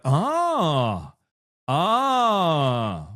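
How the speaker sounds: long drawn-out mock-interested "ah"; feigned interest. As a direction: overdo a drawn-out show of interest. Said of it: "try to use the excited voice or the interested voice".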